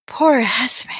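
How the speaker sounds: whispered in grief; grieving whisper. Acted. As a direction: breathe it out in sorrow.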